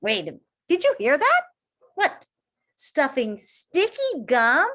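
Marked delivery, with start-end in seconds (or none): none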